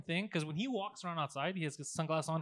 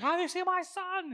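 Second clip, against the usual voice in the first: high-pitched mock holler; imitated yelling; 10 semitones higher than usual. Friend imitates mom asking him after her son, yelling high. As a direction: call it out high, like a searching mom. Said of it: "And she's yelling".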